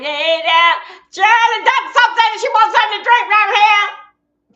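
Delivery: loud, high sing-out call. Real, not acted. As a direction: holler it high across the room. Calling out to someone off-stream.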